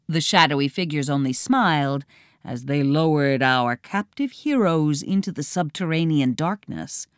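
usual speaking voice

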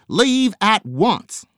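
loud high slow command; hollered command. A narrator's reading. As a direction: stretch out each word as a stern order. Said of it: "he hollered".

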